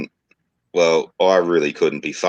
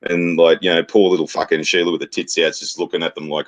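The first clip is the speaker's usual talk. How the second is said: ordinary talk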